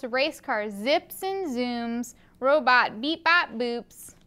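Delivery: ordinary delivery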